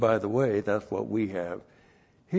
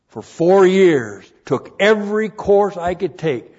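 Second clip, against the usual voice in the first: loud, higher, slowed emphasis; preaching shout; 9 semitones higher, 14 dB louder than usual. Real, not acted. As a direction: drive it home to the congregation. Repeats phrase, now shouted.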